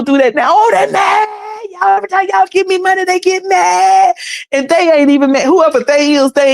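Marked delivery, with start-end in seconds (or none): 0.5-4.1 high, hammed-up preacher voice, fast; mock a preacher spinning the devil story; squealing televangelist parody. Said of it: "mocking preacher again".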